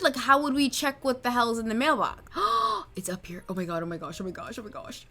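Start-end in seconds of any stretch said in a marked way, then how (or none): 2.3-2.9 sharp high intake of breath; quick shocked gasp; startled gasp. Pretend. Startled at finding the mailbox. 2.9-5.1 low, soft flustered muttering; quiet, low string of exclamations; hushed panic. Pretend. Discovers the mail, hushed panic.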